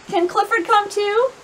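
put-on high-pitched hopeful child's question; schoolgirl's request. A narrator's reading. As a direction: ask teacher like an eager pupil. Pupil asks her teacher.

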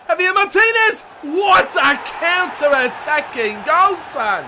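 loud, high goal roar; real excited goal call; goal celebration shout. Real, not acted. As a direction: scream the scorer's name in triumph.

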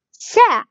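سا short and high, with a falling pitch; short fatha syllable. Shown as a demonstration. Teacher shows seen with fatha, short syllable. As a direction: say سا as one quick high syllable. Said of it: "بيجيك بالفتحة".

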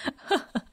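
light chuckle; short amused laugh. Acted. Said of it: "Ken laughed".

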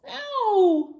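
high, loud, sustained "ow"; hammed-up drawn-out wail; howl of pain. A narrator's reading. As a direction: hold a high toothache howl. Dinosaur's cry of pain.

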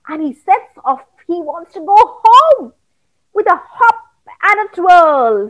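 ordinary delivery, nothing marked

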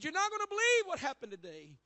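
retold shaken news. Husband bursting in to tell his wife, fast and urgent. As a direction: blurt it out, still shaken by the near miss. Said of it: "He said".

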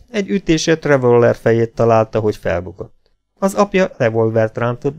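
ordinary delivery, nothing marked

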